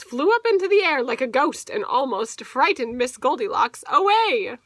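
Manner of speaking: usual speaking voice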